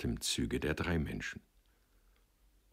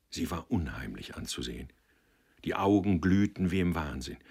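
ordinary delivery, nothing marked

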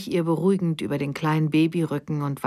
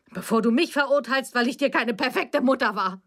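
indignant rebuke; 5 semitones higher than usual. A narrator's reading. Mother rebukes daughter in quarrel.

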